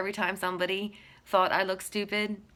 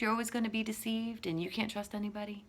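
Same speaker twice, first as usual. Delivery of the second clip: usual speaking voice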